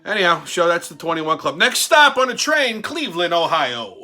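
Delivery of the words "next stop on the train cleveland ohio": slow, loud, higher train-conductor call; mock conductor. Switching topics on his show, he calls out like a conductor. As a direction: announce the next stop like a conductor.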